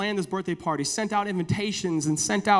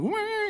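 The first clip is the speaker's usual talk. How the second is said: a sudden much higher sung wah like a keyboard sting; organ sting. Retold or imitated. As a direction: mimic the dramatic organ chord. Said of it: "she would start going".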